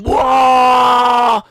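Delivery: loud, high, slow taunting chant; hammed-up crowd yelling, high and slow; mock crowd shout. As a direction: jeer like a hyped crowd. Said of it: "the crowd are going".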